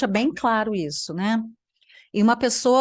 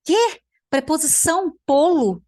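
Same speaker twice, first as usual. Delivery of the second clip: high, loud shocked "Quê?"; real astonishment at an absurd claim; shocked disbelief; 8 semitones higher, 5 dB louder than usual. Real, not acted. Shocked at "preposição polo. (espanto incrédulo em voz alta)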